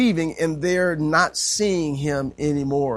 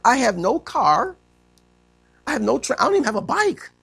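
mock homesick plea; 6 semitones higher than usual. Voicing homesick college boy.

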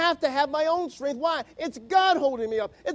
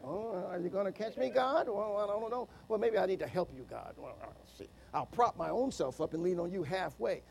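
much lower and much softer, a hesitant mumbled aside to God; mock doubter; 6 semitones lower, 10 dB quieter than usual. Preacher mimics a doubter bargaining with God, low and hushed. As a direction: act out a half-hearted believer hedging with God. Mocking a doubting believer's prayer.

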